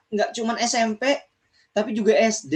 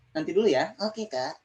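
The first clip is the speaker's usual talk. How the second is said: ordinary delivery, nothing marked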